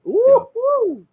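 high, loud joyful whoop; excited whoop. Real, not acted. Cohost cheering the two million prize, excited whoop. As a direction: cheer at the thought of winning.

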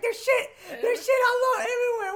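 laughing through the words. Retold or imitated. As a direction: crack up while quoting. Retelling joke, cracking up.

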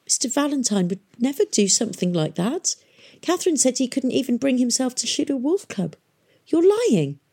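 high voice, wobbly from weeping; tearful protest. A narrator's reading. Weeping boy defends his hero to girl, tearful. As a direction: protest through tears, rising to an accusation. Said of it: "Tom's voice was all wobbly from weeping".

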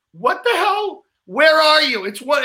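high, incredulous questions; retold bafflement. Baffled reply to late message.

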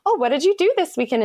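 high-pitched, friendly curious question; chatty small talk. Retold or imitated. Newcomer in London asking colleagues about their weekend, chatty. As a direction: ask it high, like office chit-chat.

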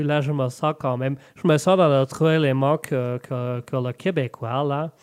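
put-on French-Canadian accent; comic Québécois accent. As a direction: play a Canadian with a broad Québécois twang. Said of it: "toi, le Canadien?".

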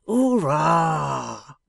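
loud, drawn-out mocking cheer; crowing cheer from a rough riverman; cried out. A narrator's reading. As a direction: let out a long rough hurrah. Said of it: "cried Riderhood".

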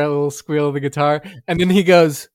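ordinary talk

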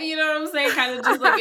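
amused giggle through the words; laughing. Real, not acted. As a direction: laugh at your own silly point. Laughing at her own chicken joke.

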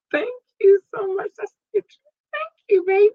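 very high squeaky thank-you voice; playful squeal. Pretend. Podcast host thanking new listeners in Bend, playful high squeal. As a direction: gush affectionately in a tiny high-pitched voice.

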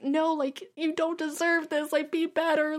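high, pleading voice at the characters; pleading fan voice. Retold or imitated. Fan pleading with the characters on screen, high.

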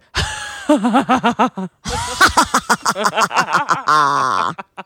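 long villainous cackle; evil laugh. Shown as a demonstration. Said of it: "like, an evil laugh".

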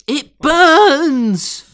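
high, loud, hammed-up wail; agonised cry. Host acting out a line from the show, melodramatic agonised cry. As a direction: cry it out in mock agony.

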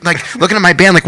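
ordinary talk, nothing marked

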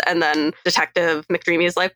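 ordinary delivery

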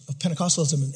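normal talking voice

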